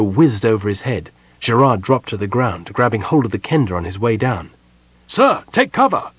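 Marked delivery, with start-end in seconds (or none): 5.1-6.1 urgent high-pitched yell; sudden high cry of alarm; yelled warning. A narrator's reading. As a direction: yell a warning to take cover from arrows. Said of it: "he yelled at Parlin".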